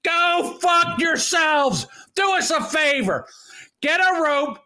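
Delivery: angry rant. Real, not acted. Angry rant at online critics.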